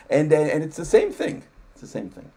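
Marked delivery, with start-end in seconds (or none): 1.7-2.3 a half-voiced afterthought; whispered aside. Real, not acted. Repeating his point to the host as a soft whispered aside. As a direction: whisper it under the breath, much softer.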